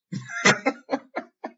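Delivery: a high unvoiced laugh; breathy laugh. Real, not acted. Laughing at his joke about the girl not repeating it. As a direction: chuckle at his own joke.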